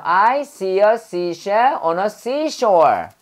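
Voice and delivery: slow, spaced-out tongue twister; deliberate teaching pace on the S words; careful S-sound drill. Shown as a demonstration. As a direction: say "I see a seashell on a seashore" slowly, each S distinct. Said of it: "S 발음할 때요".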